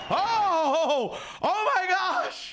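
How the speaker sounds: high, laughing, can barely get the words out; overjoyed laughing squeal. Real, not acted. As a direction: shriek with real delight at the gift. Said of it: "gonna get emotional right now".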